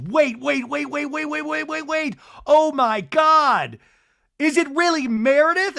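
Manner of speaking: hammed-up thrill at a surprise; excited mock squeal. Heather realizes Meredith arrives by sleigh, hammed-up thrill.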